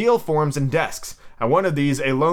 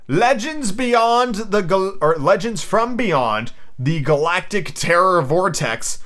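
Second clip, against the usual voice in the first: slow, drawn-out reveal; grand announcement; 4 semitones higher, 4 dB louder, slower than usual. Pretend. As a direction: announce it like a prize winner after a drum roll. Album winner announced after drum roll.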